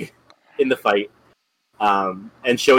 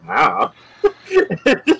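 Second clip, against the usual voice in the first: laughing; 2 semitones higher, much slower than usual. Retold or imitated. Quote breaks into laughter.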